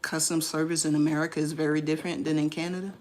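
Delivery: usual speaking voice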